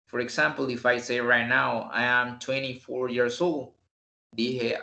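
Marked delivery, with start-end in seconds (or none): 1.9-3.7 "twenty-four" said as twenny-four; common reduced twenty. Shown as a demonstration. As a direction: drop the second t in "twenty-four". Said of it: "es más común decir twenty".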